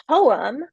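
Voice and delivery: "poem" with the emphasis on its first syllable; first-syllable stress. Shown as a demonstration. Lecturer showing students word stress, first syllable of "poem" stressed. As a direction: say "poem" leaning on PO, then easing off. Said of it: "we put the stress, the emphasis".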